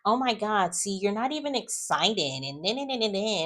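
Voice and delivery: high-pitched imitation of complaining; put-on peevish whine trailing into filler; mock mad. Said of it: "she gets mad at him".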